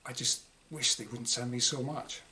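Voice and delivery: ordinary delivery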